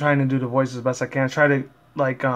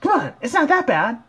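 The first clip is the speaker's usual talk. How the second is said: much higher, louder bubbly pony voice; Pinkie Pie voice; 12 semitones higher, 4 dB louder than usual. Acted. Fan voicing Pinkie Pie cheering friends up, squeaky and high. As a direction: chirp it in a squeaky cartoon voice. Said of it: "Pinkie Pie said".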